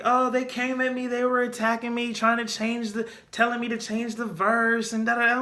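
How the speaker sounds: high, whiny imitation of a self-pitying star; sarcastic high-pitched victim act; mock victim whine. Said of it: "she tried to play the victim".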